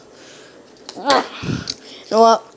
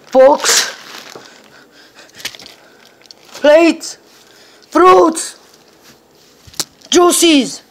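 calling out; 7 semitones higher, much slower than usual. Acted. Seeker calling out searched objects.

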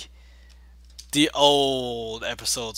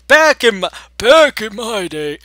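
put-on old man Yoda voice; 10 semitones higher, 9 dB louder than usual. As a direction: play a doddering old man. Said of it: "the old man Yoda voice".

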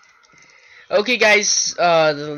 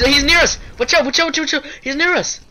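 higher, louder frantic warning; panicked shout; 8 semitones higher, 5 dB louder, much faster than usual. Real, not acted. Monster approaching, warning friend.

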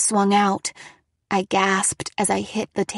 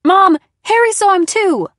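loud, high-pitched cry; desperate insistence; 10 semitones higher, 10 dB louder than usual. A narrator's reading. Daughter telling mom of a witness, desperate. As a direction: cry out your proof.

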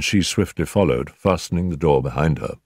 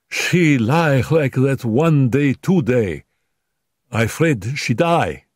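higher, slow, broken-English maid; frightened maid; 7 semitones higher, 3 dB louder, slower than usual. Acted. Dolores telling the doctor of her fear, frightened. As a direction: fret that the mistress may die.

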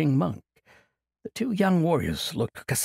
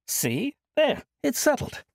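put-on high-pitched cheerful old monk brushing it off; jovial old monk; 12 semitones higher, slower than usual. Old monk cheerfully refusing Kasai's plea, jovial. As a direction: laugh off the burden like a merry elder.

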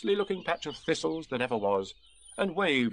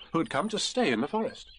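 ordinary delivery

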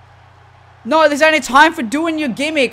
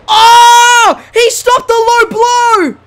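high, loud, drawn-out yell; astonished shout; 10 semitones higher, 7 dB louder, much slower than usual. Real, not acted. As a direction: explode in amazed delight. Low blow finally gets blocked.

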